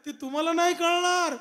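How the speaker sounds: high, loud retort; grandmother yelling back. Retold or imitated. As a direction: holler back indignantly as a grandmother. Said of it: "त्या आजी मोठ्याने ओरडल्या". (आजीचं मोठ्याने ओरडून उत्तर)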